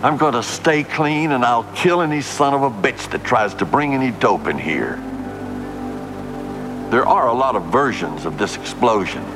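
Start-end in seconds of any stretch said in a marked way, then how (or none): none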